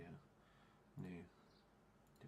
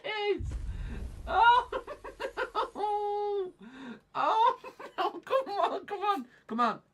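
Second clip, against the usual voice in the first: high, loud, drawn-out moans; despairing wail; 7 dB louder, slower than usual. Real, not acted. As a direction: wail helplessly, half laughing. Wailing as stream keeps failing.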